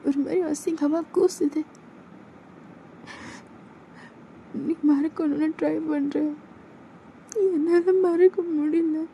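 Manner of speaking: put-on a hurt girlfriend breaking down over what happened; voiced girl, climbing from upset to despair; distressed young woman.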